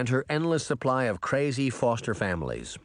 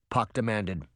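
usual speaking voice

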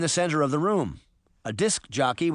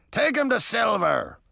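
high shouted pirate order; 8 semitones higher than usual. A narrator's reading. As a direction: shout it to your crew as a pirate. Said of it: "the first man shouted".

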